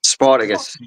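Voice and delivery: Spartacus in a Manc accent, r dropped; Mancunian Spartacus. Shown as a demonstration. Asked to say it, says Spartacus in a northern accent. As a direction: say Spartacus the Newcastle-Manc way, spɑtəkəs. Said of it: "can you say the word Spartacus".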